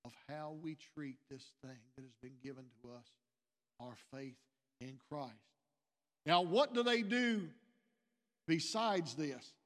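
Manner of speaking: ordinary talk, nothing marked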